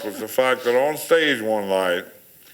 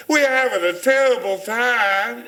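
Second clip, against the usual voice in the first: put-on thick Southern accent; 12 semitones higher than usual. Friend in the play crowd hams up his line in broad Southern accent. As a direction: lay on the broadest Southern accent you can. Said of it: "the most southern accent you ever heard".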